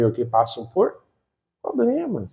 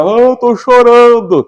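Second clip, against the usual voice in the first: high, louder mock whine; whiny believer; 8 semitones higher, 6 dB louder, slower than usual. Preacher mocking a crying believer, high and whiny. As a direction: ham up a self-pitying churchgoer. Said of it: "sou um bom em teatro".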